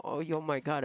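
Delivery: ordinary talk, nothing marked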